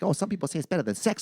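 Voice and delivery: high, quick mock Thai accent; bad Thai accent. Masseuse jokes mid-session, high mock Thai accent. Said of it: "She's like".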